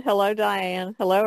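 ordinary talk

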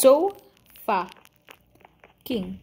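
slow, halting So, fuck, ing with gaps; broken into syllables. Real, not acted. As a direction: pause between each syllable of So fucking. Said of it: "Say it fast".

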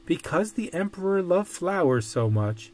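plain reading voice

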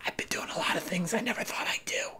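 hushed, haunted whisper; acted whisper, slightly hurried; whispered confession. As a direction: whisper it like a shameful admission. Upset client confessing to therapist.

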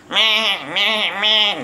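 nasal meh like a sheep; sheep bleat. Retold or imitated. Sheep escaping Ababa, mock meh bleats.

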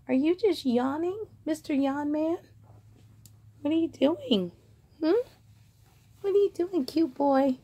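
pet talk. Real, not acted. Cooing at yawning dog.

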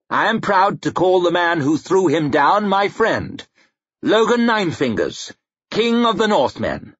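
high, ringing introduction; proud royal announcement. A narrator's reading. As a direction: present him proudly to the chamber. King proudly presenting his friend.

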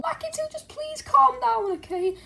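high, soft, timid squeak; squeaky wife. Acted. Wife asks husband to calm down and watch, squeaky. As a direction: coax gently in a little squeaky voice.

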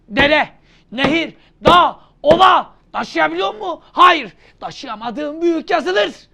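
loud, high drilling questions; emphatic teacher shout. Acted. Teacher loudly quizzing the student on the rule. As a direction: hammer the rule in.

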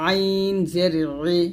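long আইন, then ই squeezed from mid-throat; squeezed ayn. Shown as a demonstration. Teacher showing ayn with zer, squeezed ই. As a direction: press the throat into a held ee on ই. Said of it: "চাপ দিয়ে বলতে হবে".

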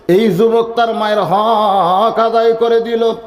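high, slow chanted call; mock shout sung in preacher's singsong; retold cry. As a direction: call it out high, stretching the key word. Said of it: "একজন লোক চিল্লায় বলে".

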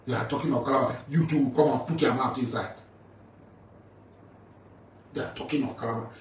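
usual speaking voice

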